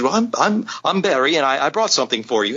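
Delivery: a friendly British artist introducing himself, lightly done; British accent. Retold or imitated. Barry introduces himself at the Comic-Con booth, in a light British accent. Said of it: "with his British accent".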